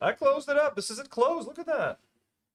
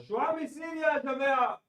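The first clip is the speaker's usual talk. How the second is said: fast, loud, high shout out the window; real furious shout in Arabic; angry shout; 8 semitones higher, 3 dB louder, much faster than usual. Real, not acted. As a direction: yell to whoever is outside. Shouting at the generator guy.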